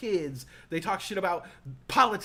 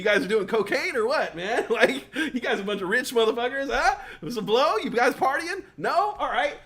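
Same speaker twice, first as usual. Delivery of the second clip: laughing, higher and louder teasing; laughing tease; 4 semitones higher, 4 dB louder than usual. Real, not acted. Teasing the wine-sipping older crowd, laughing. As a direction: needle them through a laugh.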